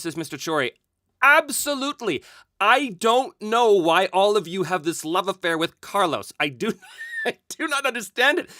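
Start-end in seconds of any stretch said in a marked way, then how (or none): 1.2-8.5 high, loud, emphatic disbelief; amused bafflement. Real, not acted. Host answering a fan about Carlos's popularity, baffled and amused. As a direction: protest it loudly, breaking into a laugh.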